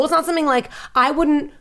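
usual speaking voice

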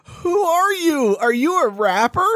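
high-pitched, slow mock bafflement; imitated clueless cashier. Cashier has no idea who Giannis is, imitated bafflement. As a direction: question it hesitantly in a put-on high voice. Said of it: "deadpan, no idea who he is".

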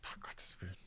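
shocked whisper, voice dropped away. Real, not acted. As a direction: breathe it out in disbelief. Shocked at two and a half hours.

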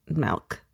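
milk said softly as melk; melk pronunciation. Shown as a demonstration. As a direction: say milk as melk, quiet and falling. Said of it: "It's M E L K is how I say".

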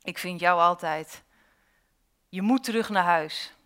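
understated sarcastic tone; controlling, with a touch of sarcasm. A narrator's reading. Mother's face to the runaway girl, mildly sarcastic. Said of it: "zei op sarcastische toon".

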